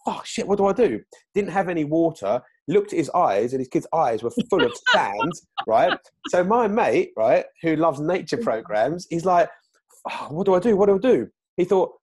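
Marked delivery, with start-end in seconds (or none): 0.1-1.0 panicked. Retold or imitated. Mate panicking over sandy baby. 10.1-11.3 panicked. Retold or imitated. Mate panicking over sandy baby.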